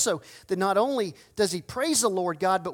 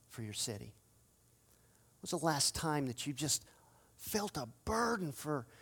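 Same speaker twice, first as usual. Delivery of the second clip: hushed searching question; 4 semitones lower, 7 dB quieter than usual. Real, not acted. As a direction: lean in and ask it quietly, almost confiding. Preacher drops to intimate questions.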